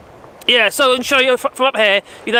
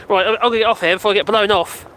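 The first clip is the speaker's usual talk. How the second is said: ordinary talk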